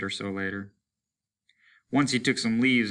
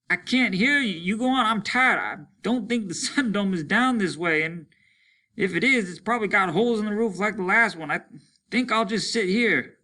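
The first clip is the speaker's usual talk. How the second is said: deafened loud talk; 11 semitones higher, 4 dB louder than usual. Acted. Deafened man loud and resigned.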